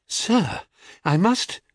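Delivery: high, demure protest; flustered wife. Acted. Procris blushes, protesting to the stranger.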